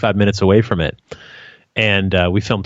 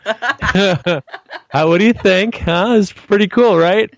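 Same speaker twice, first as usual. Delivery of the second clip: laughing; 9 semitones higher, much slower than usual. Acted. Joking about the faith healer.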